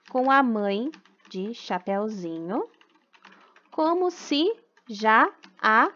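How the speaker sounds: put-on slow, even reading for children to write down; slow dictation. Teacher dictating a rewritten story to the class, slow and deliberate. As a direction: read it out word by word so the class can copy it.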